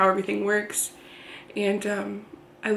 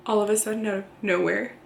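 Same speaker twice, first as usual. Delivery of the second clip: ordinary talk, nothing marked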